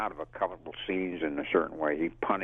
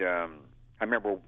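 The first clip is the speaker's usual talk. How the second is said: usual speaking voice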